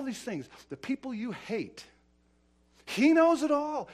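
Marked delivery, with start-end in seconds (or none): 2.8-3.9 high, loud declaration; forceful preaching. Real, not acted. Preacher on God seeing every sin, forcefully. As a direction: hammer it out at raised pitch and volume. Refrain repeated at full force.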